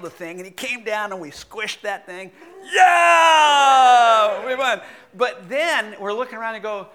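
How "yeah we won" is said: loud victory whoop; hammed-up triumphant yell; triumphant shout. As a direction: cheer like you just won the match. Victory over the spider.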